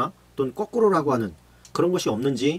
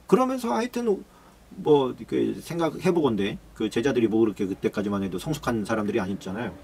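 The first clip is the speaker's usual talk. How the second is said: ordinary talk, nothing marked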